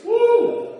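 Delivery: high whoop of amazement; excited whoop. Real, not acted. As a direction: let out a loud woo. Exclaims about Pharisees passage.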